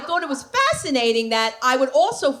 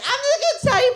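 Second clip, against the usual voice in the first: high squeal; 13 semitones higher, faster than usual. Retold or imitated. Quoting herself, high.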